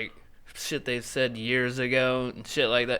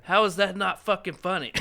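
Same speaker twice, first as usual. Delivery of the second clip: laughing, higher; 7 semitones higher, 3 dB louder than usual. Real, not acted. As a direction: get it out between laughs. Laughing at the joke.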